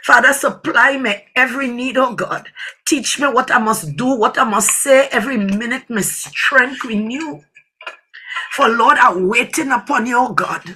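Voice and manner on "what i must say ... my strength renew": ordinary delivery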